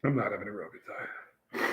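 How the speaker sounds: low, soft, miserable confession; deflated admission. Real, not acted. Pepper taster correcting himself to the host, deflated. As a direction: drop the act and admit defeat.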